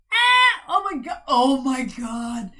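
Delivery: excited outburst. Real, not acted. Reader reacting to a message for a client, loud excited outburst. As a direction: cry out, drawing out the last oh my god.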